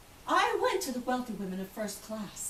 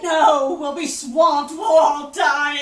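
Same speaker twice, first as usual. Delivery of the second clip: louder, slow, fearful bark; panicked refusal; 4 semitones higher, 12 dB louder, slower than usual. Retold or imitated. Frightened quartermaster refuses rescue.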